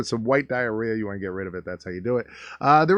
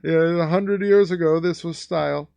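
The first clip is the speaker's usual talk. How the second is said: mock smug guy; 4 semitones higher, slower than usual. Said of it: "He's like".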